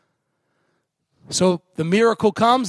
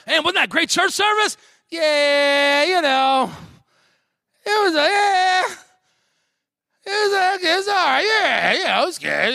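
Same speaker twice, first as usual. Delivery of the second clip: grudging churchgoers; 8 semitones higher than usual. Acted. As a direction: imitate a half-hearted critic with long, high yeahs. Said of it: "There'll be people that'll walk in".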